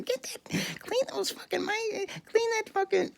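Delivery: hammed-up shrill nag in falsetto; mock yelling wife. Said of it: "His wife was yelling at him".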